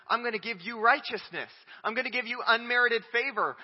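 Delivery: preacher booming God's promise; voicing God. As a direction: speak God's promise out boldly.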